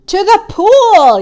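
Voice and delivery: high, loud, drawn-out call; yell. A narrator's reading. Firemen answering where to take the fish, yelling. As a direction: yell the destination, stretching it slowly. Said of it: "yelled the firemen".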